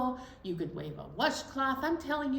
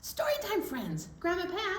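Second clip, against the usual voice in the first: bright high sing-song call; greeting called up high; 10 semitones higher than usual. Real, not acted. Host calling out greeting.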